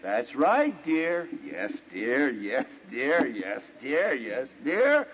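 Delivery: henpecked, singsong agreement with rising endings; mock-submissive husband; very meek. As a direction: give in meekly, phrase by phrase. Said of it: "you could very meekly say".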